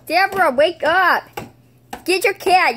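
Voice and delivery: loud, high holler to wake someone; play-acted wake-up yell; mock-urgent shout. Acted rousing of unresponsive friend.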